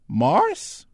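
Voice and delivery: very high, loud questioning call; high-voiced interrupting query. A narrator's reading. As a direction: pipe up deferentially to get attention. Newcomer calls out to soldier.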